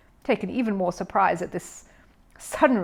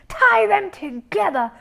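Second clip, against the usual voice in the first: slow, much higher, louder outraged splutter; 13 semitones higher, 7 dB louder, slower than usual. A narrator's reading. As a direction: splutter in disbelief. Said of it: "spluttered Mister Curry".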